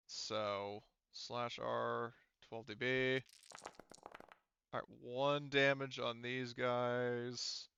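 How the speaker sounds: ordinary talk, nothing marked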